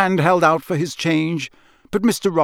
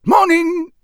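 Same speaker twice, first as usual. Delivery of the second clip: much higher, louder character voice; Muggle's gruff reply; 7 dB louder than usual. Acted. Muggle returning a visitor's greeting, high and loud. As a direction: answer loud and high as the local.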